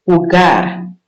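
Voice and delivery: "jugar" said as huGAR, the J an H; Spanish J as H. Shown as a demonstration. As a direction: breathe the J of "jugar" like an H. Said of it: "J, you say H".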